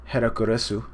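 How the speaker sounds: "Herakuresu" with an r for the l; Japanese pronunciation. Shown as a demonstration. Giving the Japanese name, "Herakuresu" said in Japanese syllables. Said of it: "in Japanese, it was".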